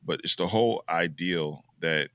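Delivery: ordinary delivery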